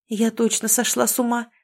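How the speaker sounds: usual speaking voice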